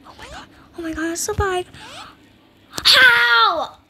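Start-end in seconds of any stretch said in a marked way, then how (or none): 2.7-3.8 loud, high outburst; anguished gamer shout; frustrated yell. Real, not acted. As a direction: yell it out in disbelief. Keeps losing at the game.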